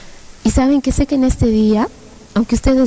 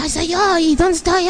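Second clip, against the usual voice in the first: high, acted bewildered voice; startled bewilderment; 8 semitones higher than usual. Hearing her own voice while flying, startled. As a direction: act lost and amazed, pitched high. Acts own voice heard in vision.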